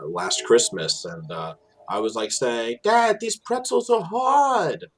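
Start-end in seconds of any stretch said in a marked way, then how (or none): 2.8-4.9 high, slow, heavy Jersey drawl on "hard"; young Jersey voice whining to dad about hard pretzels; thick Jersey accent. Retold or imitated. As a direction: imitate his old self stretching "Hard" high and slow. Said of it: "watched this old video of myself".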